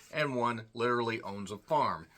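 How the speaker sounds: ordinary talk, nothing marked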